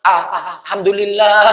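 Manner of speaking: stammering, stuck on the first sound; a stammering start to the prayer; mock stutter. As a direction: imitate a stuttering imam.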